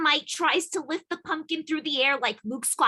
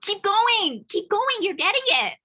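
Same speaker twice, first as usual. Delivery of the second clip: cheering on; 9 semitones higher, 5 dB louder than usual. Real, not acted. Cheering the pug on.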